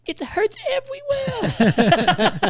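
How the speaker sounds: put-on high, slow whimper of a small boy; hurt little kid. Father imitating his crying son after the crash, high slow whimper. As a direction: draw it out like a hurt child. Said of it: "And he goes".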